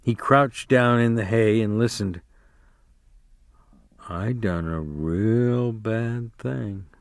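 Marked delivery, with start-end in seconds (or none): 4.1-6.9 softer, slow dread; quiet dread. A narrator's reading. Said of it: "he said".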